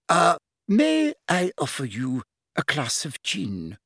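slow, high, over-refined offer; extra refined voice. A narrator's reading. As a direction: put on extra-refined airs, slowly. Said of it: "in an extra refined voice".

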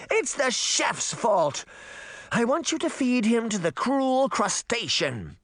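high-pitched, vindictive royal; petulant princess. Acted. Princess condemns the chef, high and petulant. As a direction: pass sentence in a high, spoiled voice.

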